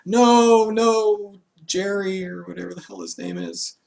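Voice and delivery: high, frantic cries of protest; panicked cries. Retold or imitated. Other foods pleading as the sausage is carried off, high and frantic. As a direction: cry out as they watch him taken.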